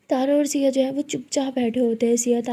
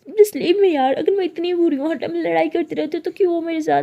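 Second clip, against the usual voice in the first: hurt outburst; 5 semitones higher, 5 dB louder than usual. Acted. Tearful girlfriend lashes out.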